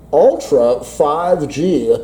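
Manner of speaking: lightly voiced radio DJ; a hint of a radio host. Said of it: "I've just gone over to my D J voice".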